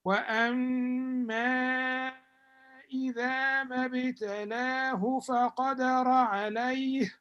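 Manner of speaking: measured Quranic recitation. Acted. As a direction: draw out each word in chant. Teacher recites verse.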